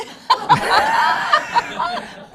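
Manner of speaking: high burst of laughter at own joke; real belly laugh, high and loud; big laugh. Real, not acted.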